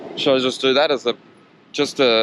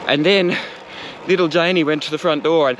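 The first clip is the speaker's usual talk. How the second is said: hammed-up suspense, pitched up; playful spooky storytelling, raised pitch; campfire tale; 5 semitones higher than usual. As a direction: build mock dread toward the scare. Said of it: "campfire tales or something".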